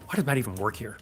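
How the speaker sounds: muttered teasing aside; mock quiet dig. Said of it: "No, I'm just kidding".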